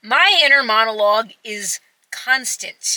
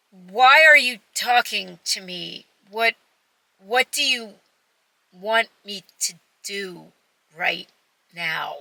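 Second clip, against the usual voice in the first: blank, stalled delivery with long gaps, fading away; unintentionally rude; 2 semitones lower than usual. Retold or imitated. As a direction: imitate a brain shutting down mid-question. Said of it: "I must have sounded so rude".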